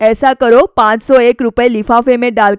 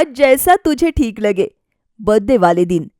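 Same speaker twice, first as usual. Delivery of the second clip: usual speaking voice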